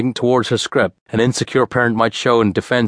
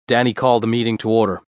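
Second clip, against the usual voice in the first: plain reading voice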